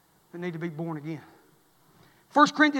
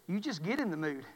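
softer, gentle mock wife; 5 semitones lower, 8 dB quieter than usual. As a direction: pat his hand and say it softly. Wife gently soothing husband.

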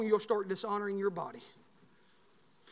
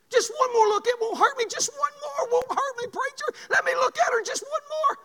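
higher, wheedling plea; pleading wife; 14 semitones higher, 5 dB louder than usual. Acted. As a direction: beg for one more look. Lot's wife begging one look.

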